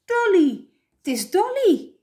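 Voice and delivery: high, loud cry of recognition; joyful shout. A narrator's reading. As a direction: shout it over everyone. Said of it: "schreeuwde toen boven iedereen uit".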